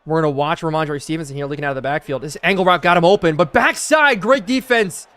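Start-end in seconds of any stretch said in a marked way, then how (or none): 2.2-5.1 loud, rising play-by-play shout; excited play call. Real, not acted. Streamer calling a great defensive stop, excited shout. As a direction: burst out as the defense makes the play.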